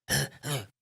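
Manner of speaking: mock gasp on waking; acted sudden intake of breath; startled waking gasp. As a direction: gasp as if jolted from sleep. Acts out waking on the couch.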